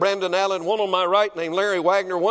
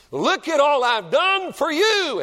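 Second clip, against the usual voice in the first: proud Pharisee; 10 semitones higher, 5 dB louder, slower than usual. Retold or imitated. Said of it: "in essence, is what he said".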